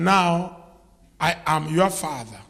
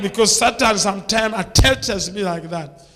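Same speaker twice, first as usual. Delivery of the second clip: loud, driving sermon climax; preacher declaring he will expose his past, loud and driving; full-force preaching; 3 semitones higher, 7 dB louder than usual. Real, not acted. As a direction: shout it out against Satan.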